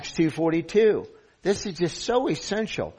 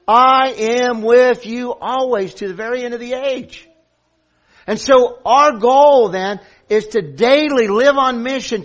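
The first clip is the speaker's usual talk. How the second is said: raised, louder pulpit voice; forceful preaching; 6 semitones higher, 8 dB louder than usual. Real, not acted. Preacher tells the church of its commission, preaching forcefully. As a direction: drive the point home to the congregation.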